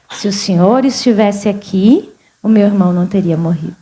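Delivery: low, measured quotation; sober restatement. Retold or imitated. Preacher restates Martha's words to the congregation, sober restatement.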